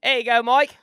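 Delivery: high, loud mock English accent. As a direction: put on a hammy English accent. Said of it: "like, a, an English guy".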